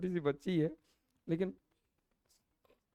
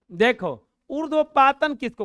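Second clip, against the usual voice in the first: calling out; 6 semitones higher, 8 dB louder than usual. Real, not acted. Teacher calls out to class.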